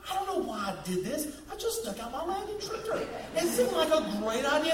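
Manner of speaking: ordinary talk, nothing marked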